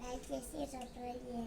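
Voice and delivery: small, barely audible recited line; speaking softly. Real, not acted. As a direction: rush it out under your breath. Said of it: "ఇప్పుడు ఎందుకు మెల్లగా మాట్లాడుతున్నావు".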